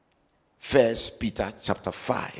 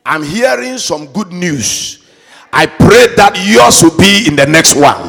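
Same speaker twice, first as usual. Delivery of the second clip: fiery preaching; 6 semitones higher, 15 dB louder than usual. Acted. Preaching at full force.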